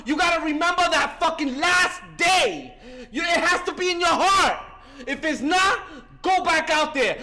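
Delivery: loud, driving preaching to the room; fiery recovery sermon. Real, not acted. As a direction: hammer the message home like a preacher.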